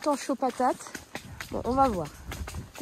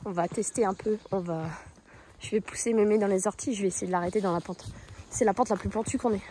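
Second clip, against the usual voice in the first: ordinary delivery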